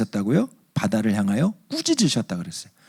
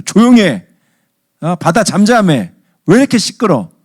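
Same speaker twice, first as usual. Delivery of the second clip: loud, high-pitched exasperated outburst; furious, irritated; 8 semitones higher, 8 dB louder than usual. Acted. Jesus telling off the noisy sea, hot anger. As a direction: yell it like someone just woken up. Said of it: "엄청 열받으신 상태로".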